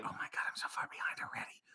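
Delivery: rushed, barely audible mutter to yourself; whispered aside. Real, not acted. Streamer noticing he is behind on chat, whispered aside. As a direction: whisper it quickly under your breath.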